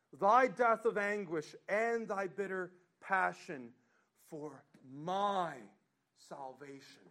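loud, swelling recitation of the hymn; fervent hymn reading at full voice; hymn read aloud. Acted. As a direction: proclaim each line of the verse. Reads hymn stanzas with force.

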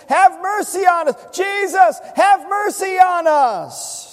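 high shouted plea across a distance; crying out loudly. Acted. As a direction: shout for mercy so he hears from afar. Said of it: "loudly so he can hear them from the distance".

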